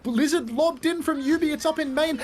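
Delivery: high, building play-by-play call; esports caster. Real, not acted. Live play-by-play.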